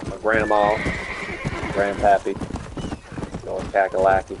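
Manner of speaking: quick, light mock Southern twang; Southern accent. Streamer joking about his Southern blood in a mock Southern accent. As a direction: toss it off in a playful Southern accent. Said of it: "to be talking like this".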